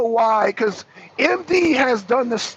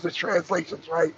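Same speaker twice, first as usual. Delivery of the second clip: ordinary talk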